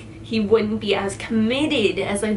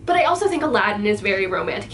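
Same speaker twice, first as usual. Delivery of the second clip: ordinary delivery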